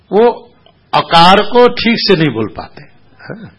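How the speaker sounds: ordinary delivery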